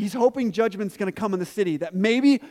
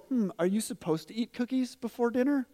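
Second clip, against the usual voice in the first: questioning parent; 3 semitones higher, 5 dB quieter than usual. Retold or imitated. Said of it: "pose a question".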